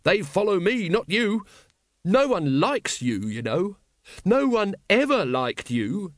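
high, gloating boast; worked-up, stretched-out gloating; triumphant. A narrator's reading. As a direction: crow over a beaten rival. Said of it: "said Once triumphantly".

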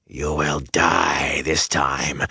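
slow, whispered creature rasp; dry whisper like crumbling dead leaves; rasping Myrddraal. Acted. Myrddraal threatens Rand mid-duel, in a slow rasping whisper. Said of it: "rasped at him in a voice like the crumbling of dead leaves".

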